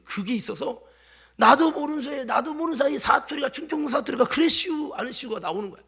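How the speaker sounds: usual speaking voice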